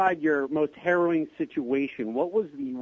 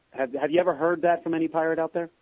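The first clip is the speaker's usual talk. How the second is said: ordinary delivery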